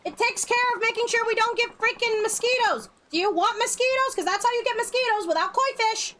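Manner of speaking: high, outraged complaint; indignant. Real, not acted. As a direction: demand the koi, appalled. Continuing koi rant.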